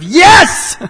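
high, loud, triumphant yelling; loud victorious shout. Pretend. Healed man shouting to the crowd, victorious and loud. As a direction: burst out shouting with joy. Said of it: "You would probably respond in a loud, victorious sort of".

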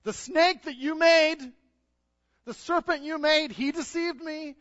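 high, loud, slow whining blame; whining Eve. Acted. Eve to God, high whining excuse. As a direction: whine and shift the blame. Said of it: "What did you do?".

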